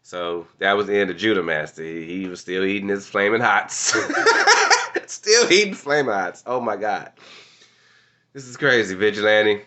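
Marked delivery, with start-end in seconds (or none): 4.0-5.0 squealing rising giggle; high giggle. Real, not acted. Laughing about Judah eating Flamin' Hots, high giggle.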